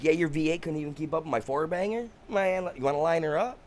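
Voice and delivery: high-pitched, goading brag; retold taunt, pitched well up; cocky challenge. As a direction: throw down a cheeky street-race dare. Retelling his boast to the cop.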